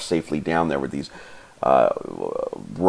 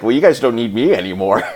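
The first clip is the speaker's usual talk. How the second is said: amused translator; 4 semitones higher, 4 dB louder, faster than usual. Retold or imitated. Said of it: "she's like".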